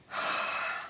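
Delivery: long, soft breathy sigh; quiet exhale after venting; heavy sigh. Real, not acted. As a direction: let out a weary breath. Pause after venting frustration.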